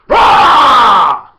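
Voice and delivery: huge roaring lion shout; big mock lion roar. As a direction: roar like a lion about to pounce. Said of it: "When a lion is about to bounce".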